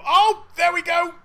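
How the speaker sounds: high, loud exclamation; startled shout. Real, not acted. Player seeing his gift snatched away, startled shout. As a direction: cry out at a sudden surprise.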